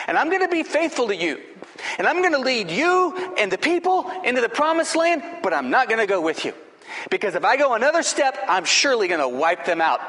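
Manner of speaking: irritated God. Acted. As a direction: speak as God losing patience with Moses. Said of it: "God became so irritated that he said".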